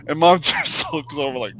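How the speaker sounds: usual speaking voice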